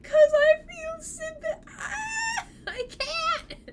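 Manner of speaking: high, slow hammed-up crying wail; high mock-weepy whine, slowing down. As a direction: fake a tearful sob of sympathy. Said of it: "It's like".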